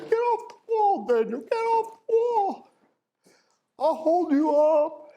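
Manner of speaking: high-pitched urging, voice leaping up; urgent coaxing. Acted. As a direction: call out high to a nervous skater. Stacy urging Daniel off the wall.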